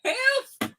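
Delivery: high playful yelps of "Help!"; hammed-up rescue call, rising; mock cry for help.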